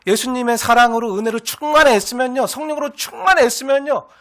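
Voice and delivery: ordinary talk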